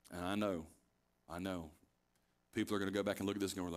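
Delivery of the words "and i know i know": low, hushed acknowledgement; quiet concession. Real, not acted. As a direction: concede it softly, voice dropped. Preacher concedes listeners' expected objection.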